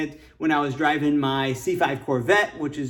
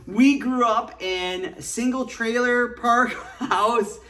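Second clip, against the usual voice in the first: laughing; 7 semitones higher, slower than usual. Real, not acted. Laughing while recalling trailer park.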